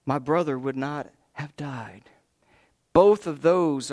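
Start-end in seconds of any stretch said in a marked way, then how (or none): 0.1-2.0 hushed whisper of sorrow; whispered grief. Acted. Martha kneels before Jesus, whispered grief. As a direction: breathe it out grieving.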